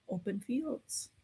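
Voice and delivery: usual speaking voice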